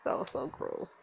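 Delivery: whispered so gross; disgusted whisper. Real, not acted. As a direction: hiss out disgust under the breath. Disgust at Victor.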